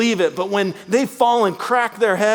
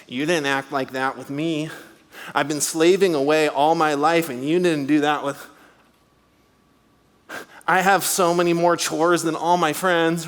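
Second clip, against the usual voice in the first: nasal, whining sulky brother; whiny nasal brother; 5 semitones lower than usual. Retold or imitated. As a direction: complain through the nose like a resentful teen. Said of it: "in a nasally voice".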